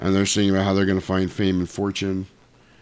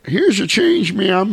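high, louder chirpy service voice; Toll Booth Willie; 11 semitones higher, 5 dB louder, slower than usual. Retold or imitated. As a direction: hand it over in a high character voice. Willie politely serving a customer.

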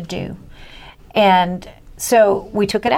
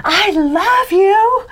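put-on high, drawn-out little boy's holler; imitated child's yell; 11 semitones higher, 3 dB louder, much slower than usual. Little boy yells to woman from other end of aisle, mock yell. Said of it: "he yells out".

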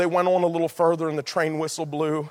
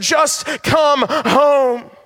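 shouted call; 10 semitones higher, 7 dB louder, much slower than usual. Acted. Climactic call to congregation.

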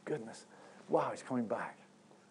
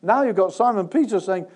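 ordinary delivery

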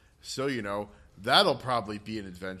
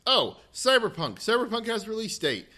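high, loud burst of excitement; real excited announcement, pitched up; excited realization; 11 semitones higher, 6 dB louder than usual. Real, not acted. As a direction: blurt it out as it comes back to you. Suddenly remembers big game news.

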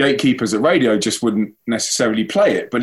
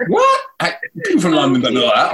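squeaky high "what", then protesting; mock disbelief; 6 semitones higher than usual. Stranger doubting that he is from London, mock disbelief. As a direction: imitate an incredulous stranger, pitch leaping up. Acted disbelief at his answer.

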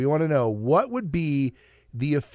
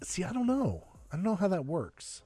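whispering; 5 semitones higher, 6 dB quieter than usual. Real, not acted. Unvoiced, puzzled answer.

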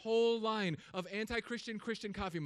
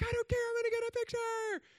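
very high, giddy squeal; stubborn excited squeal. Retold or imitated. Answering friends who hush him, very high. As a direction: shriek it like a defiant child. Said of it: "I'm like".

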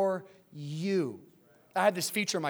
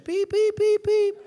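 high, louder mock beeps; loud beeping; 14 semitones higher, 7 dB louder than usual. Pastor voicing God's beeping to reluctant churchgoers, loud high beeps. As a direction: blare the beeps at the congregation. God voiced as beeping car.

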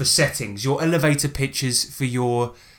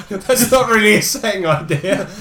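amused, higher and louder, laugh in the words; 8 semitones higher, 3 dB louder than usual. Real, not acted. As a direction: say it while chuckling. Amused at the mistaken entry.

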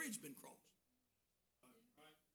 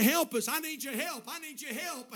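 urgent plea; 7 semitones higher, 5 dB louder than usual. Retold or imitated. Caller begging the preacher for help.

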